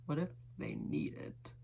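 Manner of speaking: low, soft, intimate murmur; hushed confession. Acted. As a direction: drop low and quiet as if admitting a secret. Poem's intimate confession of need.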